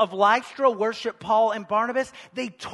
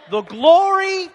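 normal talking voice

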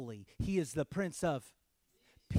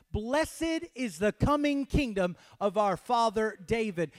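shouted verse; 5 semitones higher, 4 dB louder than usual. Shown as a demonstration. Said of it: "Can we shout that?".

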